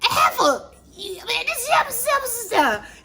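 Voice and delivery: high, mocking voice, hammed-up; sassy Drew. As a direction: throw shade like a mocking diva. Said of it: "Drew was like".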